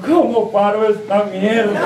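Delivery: slow, stretched-out mock holler; mock yell. Old man calling down from the flying donkey, mock yell. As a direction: call down slowly without real force. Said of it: "se asomó por un lado del burro y gritó".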